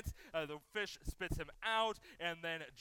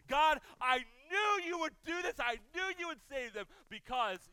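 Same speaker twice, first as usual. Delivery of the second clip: pouting Jonah; 10 semitones higher, 10 dB louder than usual. Retold or imitated. Jonah sulking at God after Nineveh repents, a loud high pouting whine. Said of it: "he starts pouting".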